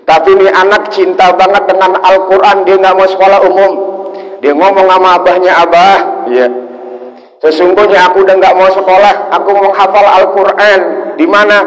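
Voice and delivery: loud, high storytelling sermon voice; preacher driving the story to the crowd; preaching at full force. Acted. Preacher tells the congregation a story, loud and high.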